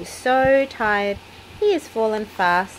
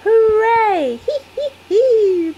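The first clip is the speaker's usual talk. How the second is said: much higher, loud piggy hooray and giggles; cheering then laughing; 9 dB louder, slower than usual. A narrator's reading. Peppa finds the coin and cheers, giggling. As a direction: squeal with joy then giggle. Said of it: "Peppa laughs".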